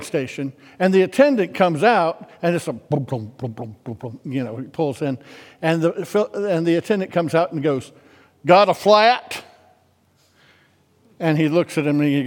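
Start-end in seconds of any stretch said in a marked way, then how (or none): 2.7-3.9 low, soft repeated booms; imitated thumping tire. Imitates flat-tired truck pulling in. 8.5-9.4 loud Southern station attendant; Southern accent. Retold or imitated. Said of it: "He's got a real Southern accent".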